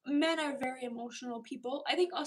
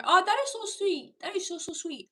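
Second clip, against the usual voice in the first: "that" jumps higher and louder, "so sweet" gushed; delighted; 5 semitones higher, 6 dB louder than usual. Real, not acted. Touched by a viewer's kind message, gushing. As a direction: gush over something lovely.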